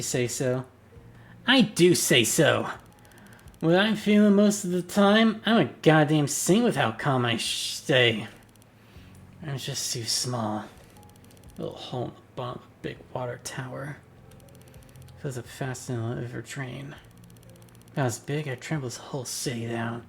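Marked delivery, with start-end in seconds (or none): 1.5-8.3 angry insistence. Acted. Detective yells that he's a calm saint, angry insistence. 9.5-20.0 quiet confession. Acted. Confessional inner monologue over music.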